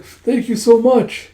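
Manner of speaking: lightly voiced old lady, high and slow; a hint of a grateful old lady. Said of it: "you see this old lady".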